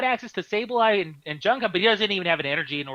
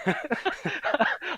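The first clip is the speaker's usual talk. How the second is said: high breathless laughing fit; helpless laughter; 11 semitones higher, 3 dB quieter than usual. Real, not acted. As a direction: laugh until you can't breathe. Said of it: "I can't even catch my breath here".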